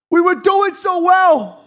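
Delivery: high, drawn-out wail of frustration; loud, slow, high-pitched lament; anguished shout. Real, not acted. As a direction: shout it in despair, stretching the words. Lamenting team's lost lead, louder.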